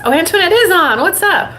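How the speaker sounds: spotting a friend who just arrived; happy surprise. Real, not acted. Guest suddenly appears on line.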